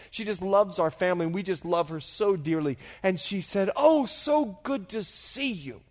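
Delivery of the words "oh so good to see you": put-on high, slow elderly lady's greeting; drawn-out, higher-pitched happy welcome; delighted old woman. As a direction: imitate an old lady lighting up. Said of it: "she just lit up".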